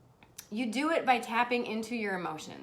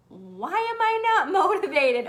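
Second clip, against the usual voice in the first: raised pitch, loud comic whine; mock exasperation; 6 semitones higher, 5 dB louder than usual. Host voicing viewers who lack motivation, mock exasperated. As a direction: laugh through an exaggerated complaint. Voices a frustrated would-be achiever.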